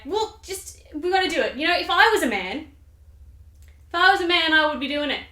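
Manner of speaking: high, insistent mock goading; pushy Lady Macbeth. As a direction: goad your husband impatiently. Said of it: "Lady Macbeth is like".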